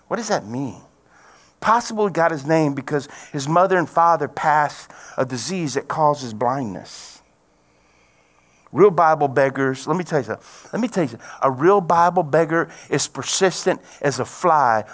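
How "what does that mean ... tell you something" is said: normal talking voice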